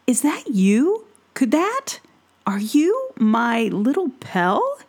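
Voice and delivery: overjoyed woodsman who can't believe it; amazed father. Acted. Woodsman recognizes his son.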